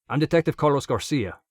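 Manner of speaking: put-on detective introducing himself with a Spanish accent; strong Spanish accent. A narrator's reading. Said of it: "in a strong Spanish accent".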